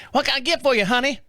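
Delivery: waitress calling him honey, high and sugary; diner waitress voice. Retold or imitated. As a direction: play the folksy diner waitress. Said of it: "she looked like Flo from Mel's Diner".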